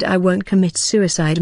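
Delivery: usual speaking voice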